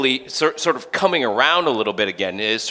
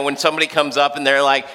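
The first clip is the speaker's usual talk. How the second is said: ordinary delivery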